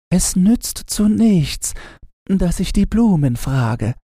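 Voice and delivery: put-on high, girlish and worried; a longing little girl, pitched high; little girl Gretchen. Gretchen worries aloud about grandmother, high girlish voice.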